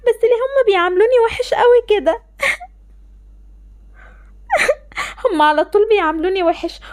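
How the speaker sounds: choked, tearful lament; crying. Acted. Girl complaining alone about her parents, tearful. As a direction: cry it out, hurt and confused.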